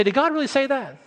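coy insinuating question; sly doubter. Retold or imitated. False teacher whispering to the sheep, sly doubt. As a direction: plant doubt with a sly tilt. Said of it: "These come in and say".

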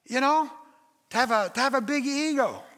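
ordinary delivery

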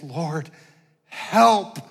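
brief plea breaking upward on the last word; begging prayer. Acted. Believer with no words begging God, desperate plea. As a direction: beg with few words left. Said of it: "you're just begging God".

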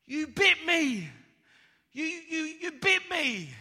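high, drawn-out accusing shout; outraged boy. Acted. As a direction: yell the accusation at the snake. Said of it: "And he goes to the snake".

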